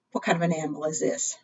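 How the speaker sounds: normal talking voice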